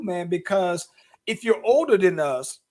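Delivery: normal talking voice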